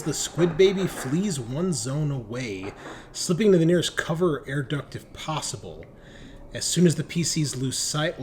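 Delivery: game master reading out a creature ability; dramatic rules read-aloud; reading the rulebook text. Acted. GM reads monster ability card.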